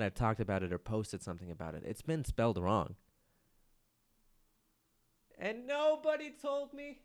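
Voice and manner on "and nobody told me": high, slow whiny complaint; mock indignant. Real, not acted. Host complaining nobody flagged the typo, high mock whine. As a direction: squeal it like you're wronged.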